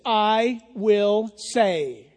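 "I will say" drawn out very slowly, each word weighed; very slow emphasis. Shown as a demonstration. Slow, weighty line for the congregation. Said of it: "his action is this".

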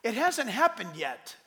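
usual speaking voice

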